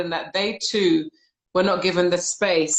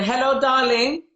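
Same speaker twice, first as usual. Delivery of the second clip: slow, higher, tender hello; warm greeting; 6 semitones higher, slower than usual. Retold or imitated. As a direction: greet her like a loving church sister. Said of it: "just with her saying".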